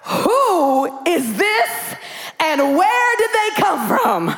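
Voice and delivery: drawn-out, very slow who is this; baffled disbelief. Retold or imitated. Spouse wondering who this stranger is, slow baffled disbelief. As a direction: stretch it out in mock bewilderment. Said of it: "you're like".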